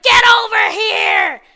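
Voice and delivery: loud, high drawn-out yell; mock attack shout. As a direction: imitate an enraged kid, hammed up. Voices the kid attacking Nick.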